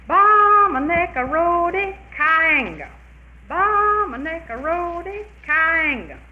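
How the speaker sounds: high, loud drawn-out holler; long chanted call to the dogs. Retold or imitated. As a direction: sing out to far-off hounds. Man calling the dogs.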